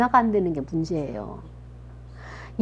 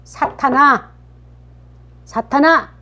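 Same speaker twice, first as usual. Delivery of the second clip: raised, commanding reprimand with pauses between calls; stern rebuke; 2 semitones higher, 3 dB louder than usual. Acted. Jesus to his disciple Peter, rebuking him sternly. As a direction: scold sharply, calling out then ordering away. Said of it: "꾸짖는데 어떻게 꾸짖어요".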